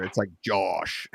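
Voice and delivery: Josh with the O drawn out long and slow; stretched O, Pittsburgh-style. Shown as a demonstration. Streamer shows how his name sounds after drinks, drawn-out O. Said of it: "the O in my name gets longer".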